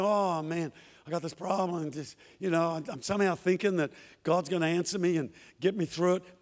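low, mumbled grumbling about a problem; defeatist moaning. Pretend. Believer moaning to himself about his problem, low and defeatist. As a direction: mope through the words without hope. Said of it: "Don't talk like that".

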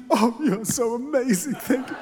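soft, hammed-up teary thanks, half laughing; mock tearful gratitude. Pastor mocks kids thanking parents, teary and hammed-up. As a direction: overdo a grateful child's sob for the joke. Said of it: "Tears of gratitude".

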